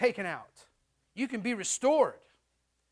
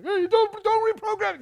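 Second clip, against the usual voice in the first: high, loud, whiny protest; the mind pleading not to be reprogrammed; 12 semitones higher, 9 dB louder than usual. Pretend. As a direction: squeal it like a program begging not to be deleted.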